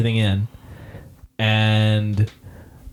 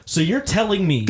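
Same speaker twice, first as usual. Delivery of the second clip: incredulous; 8 semitones higher than usual. Retold or imitated. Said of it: "I was like".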